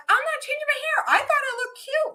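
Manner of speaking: high-pitched mock indignation; defensive protest. Mom refusing son's advice on her hair, defensive. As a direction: push the pitch way up, wounded vanity. Retold argument with son about hair.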